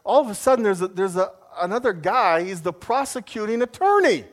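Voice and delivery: ordinary talk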